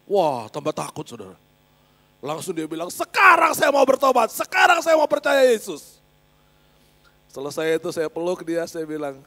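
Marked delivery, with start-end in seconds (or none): 2.9-6.0 high, loud cry of conversion; retold shouting. Classmate declaring he will repent, shouting. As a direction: shout it like an urgent vow. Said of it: "dia teriak-teriak".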